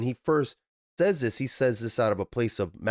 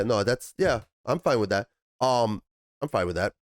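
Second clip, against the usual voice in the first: ordinary delivery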